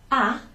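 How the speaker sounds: A as a single open "ah"; silent h. Shown as a demonstration. Teacher showing learners how ha differs from hai, A as "ah". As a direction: say A as "ah", no glide and no h. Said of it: "listen for the difference in the pronunciation".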